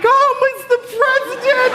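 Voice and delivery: thrilled as a fan spotting a star; starstruck squeal. Retold or imitated. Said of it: "I'm like".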